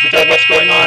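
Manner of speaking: robot breaking down, high and loud; rusty robot panicking. Acted. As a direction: shout in panic as the gears seize. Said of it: "Gears are grinding".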